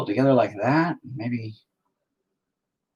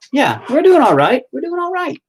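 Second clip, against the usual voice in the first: sing-song; 9 dB louder than usual. Pretend. High sing-song reassurance.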